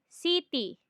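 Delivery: "city" slow, si-ti with a hard t; Filipino mispronunciation. Shown as a demonstration. Teacher lists commonly mispronounced words, slow "city" as si-ti. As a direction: say "city" as "see-tee". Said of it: "commonly mispronounced words".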